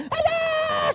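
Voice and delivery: sudden high shout of warning; yelled warning. Retold or imitated. Preacher showing a startling shout on stairs. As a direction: snap out a sharp alarm. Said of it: "saudara teriak".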